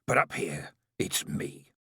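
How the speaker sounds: menacing near-whisper; hissed. A narrator's reading. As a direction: hiss it through mud-caked lips, barely voiced. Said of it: "he hissed".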